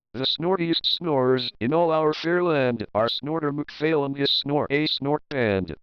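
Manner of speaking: plain reading voice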